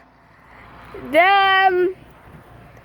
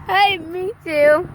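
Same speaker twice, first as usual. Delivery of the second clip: ordinary talk, nothing marked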